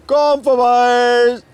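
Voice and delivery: loud, high, slow fish-market barker; market crier. Acted. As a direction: bellow it like a market stall crier. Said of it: "so als Marktschreier".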